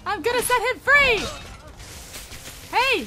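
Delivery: loud, high defiant yell; battle shout. Real, not acted. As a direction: yell it as you charge in. Charging the Thalmor in combat.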